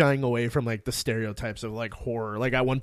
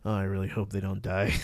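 deadpan worried aside; 5 semitones lower, 5 dB quieter than usual. Retold or imitated. Pablo's worried aside about hikers.

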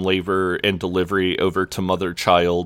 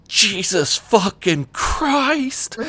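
high, drawn-out strained yell; retold yell; 12 semitones higher, much slower than usual. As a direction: imitate her yell on the final push, stretched out. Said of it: "she just yells".

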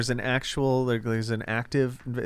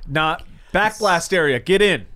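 loud mock command, higher; mock shout; 5 semitones higher, 6 dB louder than usual. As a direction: bark it like a sarcastic range officer. Mocking a bad RPG shooter.

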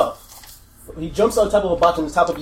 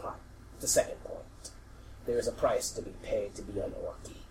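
solemn voice of a vision; 4 semitones lower than usual. Acted. Vision counsels an orc on the price, solemn and hushed.

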